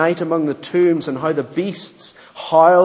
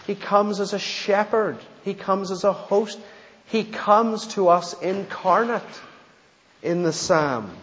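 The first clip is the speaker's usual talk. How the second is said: higher, urgent preaching cadence; rising preaching; 5 semitones higher than usual. Acted. Preacher pressing the point to the congregation, rising fervor.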